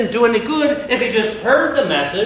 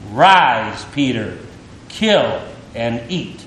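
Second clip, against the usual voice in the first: lower, slow and solemn heavenly voice; lightly voiced divine command; 8 semitones lower, slower than usual. A narrator's reading. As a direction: speak as the voice of heaven, grave and slow. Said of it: "I heard a voice saying to me".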